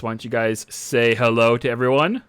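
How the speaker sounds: ordinary talk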